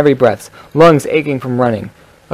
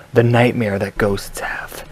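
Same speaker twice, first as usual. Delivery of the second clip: slow, unvoiced sexy whisper; 2 semitones lower, 5 dB quieter, slower than usual. Shown as a demonstration. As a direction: breathe the title like a seduction. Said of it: "that just turned into my sexy voice".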